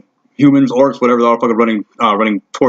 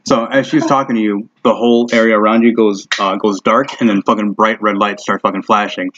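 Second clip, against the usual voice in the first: ordinary talk, nothing marked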